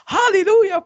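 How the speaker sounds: high, loud hammed-up hallelujah; mock preacher shout. Mocking preachers who keep shouting, loud mock hallelujah. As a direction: belt it like an overzealous preacher.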